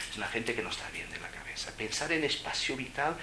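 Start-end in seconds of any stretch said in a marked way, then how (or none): 0.1-1.7 cheeky whisper; whispered aside. Real, not acted. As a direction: hush it as a naughty aside. Cheeky aside about monks. (susurro pícaro)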